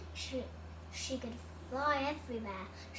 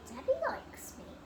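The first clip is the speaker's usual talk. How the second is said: higher, brightening cat's thought; hopeful thought; 12 semitones higher than usual. A narrator's reading. Cat realising Debbie likes her, hopeful. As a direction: lift it with sudden hope. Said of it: "Then she thought".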